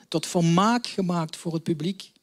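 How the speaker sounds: ordinary talk, nothing marked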